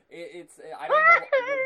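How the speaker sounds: high squeaky laughing words; laughing at the failed falsetto. Real, not acted. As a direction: laugh through it at your own attempt. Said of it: "It's horribly painful".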